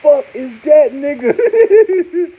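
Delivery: slow, high, loud amazed holler; amazed shout. Retold or imitated. Fan marveling at the lawyer, slow amazed shout. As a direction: shout it out in disbelief. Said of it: "I say".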